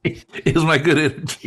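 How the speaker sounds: real laugh running into the words; tickled by the compliment; amused. Real, not acted. Amused reply to co-host's compliment.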